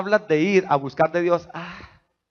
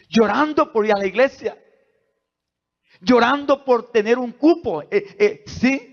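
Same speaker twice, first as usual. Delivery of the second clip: higher, louder, rising with passion; excited preaching; 9 semitones higher, 4 dB louder than usual. Acted. Pastor marvelling at children crying for church, excited preaching. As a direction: lift the church with joy.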